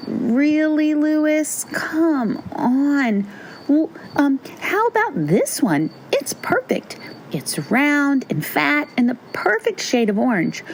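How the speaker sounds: high-pitched, coaxing sister voice; high, impatient big-sister character; exasperated big sister. A narrator's reading. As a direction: urge a little brother along in a high voice. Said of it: "His big sister, Louise, finally said".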